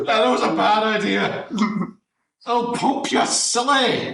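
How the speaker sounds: high-pitched comic parody voice; high and silly, slowing for the punchline; Hagrid. Acted. Hagrid parody riffing for a friend, in a high silly voice. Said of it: "and Hagrid is just, like".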